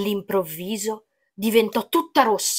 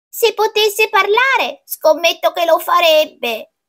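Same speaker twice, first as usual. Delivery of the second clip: grandmother; 8 semitones higher than usual. A narrator's reading. Grandmother musing about the pumpkin, high character voice. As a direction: start high and stretched, easing down. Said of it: "commentò la nonna".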